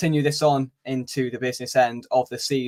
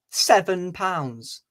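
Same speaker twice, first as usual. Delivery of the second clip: shocked price; 4 semitones higher, much slower than usual. Retold or imitated. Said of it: "Guess how much this hot dog was?".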